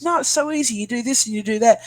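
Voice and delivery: high, sing-song mock friend; a lightly voiced know-it-all friend, breezy. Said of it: "And someone's".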